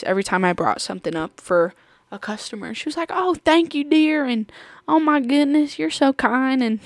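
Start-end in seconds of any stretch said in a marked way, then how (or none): 3.1-4.2 high, sweet grateful voice; super nice old lady. Retold or imitated. Old lady cashier thanking a helpful coworker, super nice. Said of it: "she was super nice". 4.9-6.6 high-pitched, gushing thanks; super nice old lady. Retold or imitated. Old lady cashier praising a helpful coworker, super nice. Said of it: "she was super nice".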